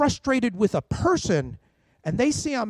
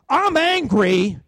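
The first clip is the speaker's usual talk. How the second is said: mock angry declaration; 4 semitones higher, 6 dB louder, slower than usual. Shown as a demonstration. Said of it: "Well, if I say".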